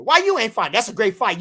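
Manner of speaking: normal talking voice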